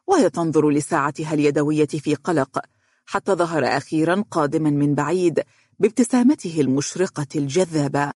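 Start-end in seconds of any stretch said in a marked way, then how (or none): none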